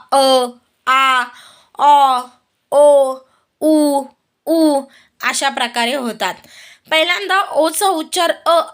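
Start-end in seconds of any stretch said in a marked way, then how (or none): 0.1-4.8 each sound alone: "अ", "आ", "ऑ", "ओ", "उ", "ऊ"; vowel sounds of O. Shown as a demonstration. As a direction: isolate each vowel from short "अ" to long "ऊ", pausing between. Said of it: "ओचे उच्चार सामान्यतः". ("अ" ते "ऊ" स्वर सुटे, स्पष्ट उच्चारून)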